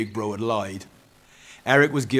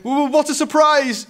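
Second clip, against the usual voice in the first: very high, loud stammered greeting; panicked stuttering; 8 dB louder than usual. A narrator's reading. Eric hides his panic from Dad, stuttering. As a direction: stutter while faking pleasant surprise. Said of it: "the panic in his eyes".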